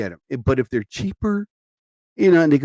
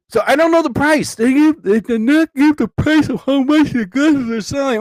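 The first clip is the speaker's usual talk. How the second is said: yawning higher and louder through the words; talking through a yawn; 12 semitones higher, 6 dB louder than usual. Real, not acted. Tired host yawning while telling listeners about prices, yawning speech. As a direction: stretch the words out mid-yawn. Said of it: "Oh my God, I yawned during that".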